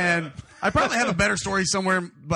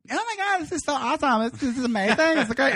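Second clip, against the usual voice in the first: high-pitched gushing excitement, mocking her; hammed-up high squeal of enthusiasm; gushing date; 8 semitones higher than usual. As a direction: imitate an overexcited date, pitched way up. Date gushing in a retold story.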